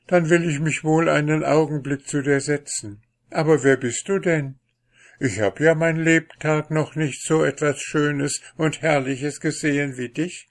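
high, wondering unlucky fellow; Pechvogel, admiring. A narrator's reading. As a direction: lightly voice an awed young admirer. (staunender Pechvogel, hohe Stimme)

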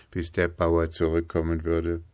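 plain reading voice

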